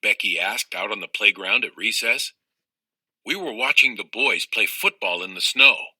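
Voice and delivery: usual speaking voice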